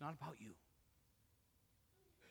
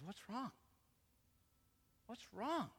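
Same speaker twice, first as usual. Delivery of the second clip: higher, gentle pleading; gracious, loving father; 7 semitones higher, slower than usual. Acted. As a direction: coax the hurt son tenderly. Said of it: "The gracious father, the loving father".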